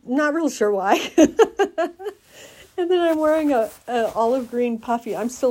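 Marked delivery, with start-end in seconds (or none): 1.2-2.1 a loud high giggle; high burst of laughter. Real, not acted. Picturing her harness boots, a high laugh.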